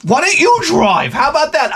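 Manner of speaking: high-pitched mock yell; exasperated challenge. Husband dares his watchful wife to drive, exasperated challenge. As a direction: throw it back at them, high and indignant.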